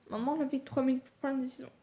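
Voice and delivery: normal talking voice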